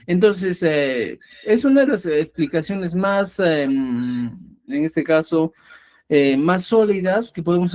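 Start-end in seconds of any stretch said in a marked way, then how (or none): none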